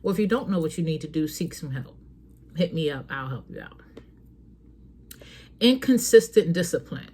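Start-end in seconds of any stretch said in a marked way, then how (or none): none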